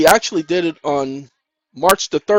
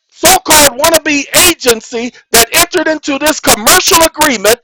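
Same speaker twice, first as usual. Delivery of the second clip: loud, high, driving sermon voice; full-force preacher pleading with the audience; fiery preaching; 8 semitones higher, 4 dB louder than usual. Acted. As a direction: preach it hard at the crowd, rising on every clause. Fiery preaching about contracts.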